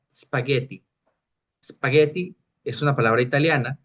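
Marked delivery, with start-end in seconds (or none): 0.1-2.4 "spaghetti" starting straight on the s; Italian pronunciation. Shown as a demonstration. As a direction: say "spaghetti" with no e before sp. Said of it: "sin la E del principio". ("spaghetti" a la italiana, sin e inicial)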